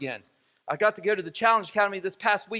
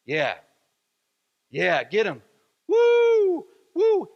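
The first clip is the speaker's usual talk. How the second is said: imitated dad's cheering; 5 dB louder, much slower than usual. Preacher replaying his dad's voice from the film, imitated cheer rising to whoops. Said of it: "I wanted to hear that voice saying".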